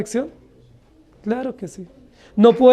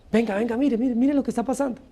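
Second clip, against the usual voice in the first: gleeful onlookers; 8 dB quieter than usual. Retold or imitated. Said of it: "ellos hicieron así".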